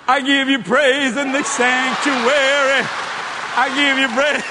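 high, slow, shouted praise; loud mock praise. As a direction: belt out church praise in jest.

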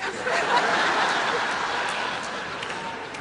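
a high, airless burst of laughter; high breathy laugh. Real, not acted. Laughs at the situation.

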